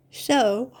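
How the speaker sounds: talking through a yawn; words stretched by a yawn. Real, not acted. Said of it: "I'm already yawning".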